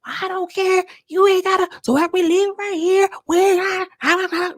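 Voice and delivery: high, pinched mock rant as Michelle. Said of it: "She's like".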